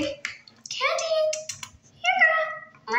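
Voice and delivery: high sing-song call for the cat; calling the pet. Acted.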